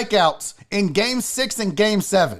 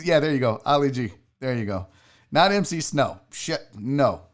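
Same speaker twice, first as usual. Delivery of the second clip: ordinary talk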